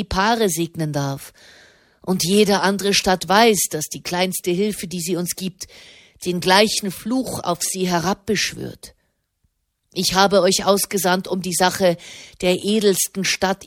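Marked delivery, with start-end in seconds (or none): none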